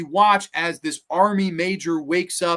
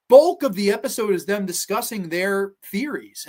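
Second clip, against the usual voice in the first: normal talking voice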